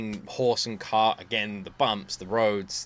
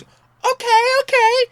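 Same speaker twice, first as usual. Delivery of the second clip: mimicking wife; 7 dB louder, much slower than usual. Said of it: "she just goes".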